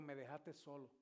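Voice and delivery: praying alone in tears at the altar. Retold or imitated. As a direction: murmur it as if crying. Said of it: "Pero en llanto, hermano".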